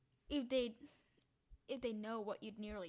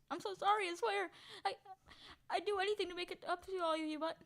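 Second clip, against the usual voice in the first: high, fast pleading apology; desperate pleading; 7 semitones higher than usual. Acted. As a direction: beg the cats frantically. Character begging the cats.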